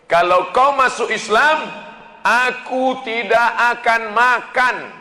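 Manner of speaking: high loud threat; a mother threatening her convert son. Retold or imitated. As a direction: warn him sharply, high and loud. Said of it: "kata emaknya".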